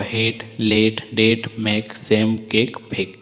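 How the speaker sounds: slow, clear word list with the final e silent; teacher reading a word list for students; model words for repeating. Shown as a demonstration. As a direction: say हेट, लेट, डेट, मेक, सेम, केक, फेक with no vowel at the end. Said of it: "देखिए टा तक ही उच्चारण हो रहा है".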